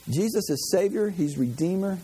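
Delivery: normal talking voice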